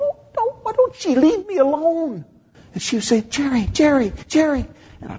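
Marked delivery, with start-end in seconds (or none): 0.0-2.2 much higher, whining plea to be left alone; high whining mock complaint; whiny annoyance. Annoyed inner thought, pestered. 3.2-4.7 much higher, insistent calling of a name; lightly voiced woman calling repeatedly. Woman calling for his attention.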